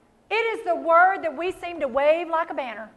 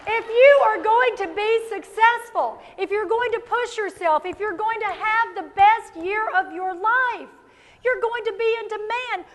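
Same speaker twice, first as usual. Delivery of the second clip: ordinary talk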